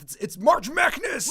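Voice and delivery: high, loud hyped announcement; excited shout. Pretend. Host announcing the month's theme, shouting excitedly. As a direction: shout it out, stretching the words.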